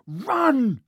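sudden high, loud shout; panicked yell of run. A narrator's reading. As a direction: yell it to make them flee. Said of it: "Harry yelled".

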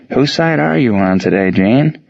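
usual speaking voice

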